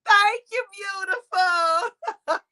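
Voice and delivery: high, drawn-out thank-you; sweet gushing thanks. Real, not acted. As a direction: coo it high, stretching the last word. Sweet thanks to a live viewer.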